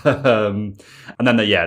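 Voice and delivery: usual speaking voice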